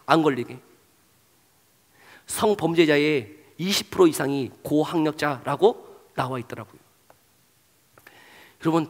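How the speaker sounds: ordinary delivery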